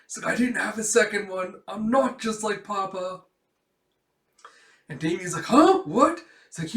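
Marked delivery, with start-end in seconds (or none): 0.3-3.2 higher, louder dismay; mock freak-out. Girl without a second handkerchief, mock freak-out. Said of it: "Anya's just freaking out". 5.4-6.2 very high, loud double-take; mock startlement. Boy caught off guard, high mock surprise.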